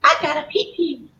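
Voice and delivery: imitated mother. Mother at the car announces her need, lightly imitated. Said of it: "she looks at me and she goes".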